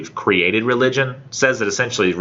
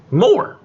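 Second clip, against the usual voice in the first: high rising stress on More; More, sung out high; emphatic rise; 5 dB louder than usual. Real, not acted. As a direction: push More up with delight. Stressing the extra meaning.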